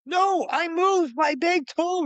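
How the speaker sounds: high, drawn-out frantic insistence; exasperated, over-excited yelling; retold freak-out. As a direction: protest wildly, stretching every word up high. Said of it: "I'm having this freak out moment".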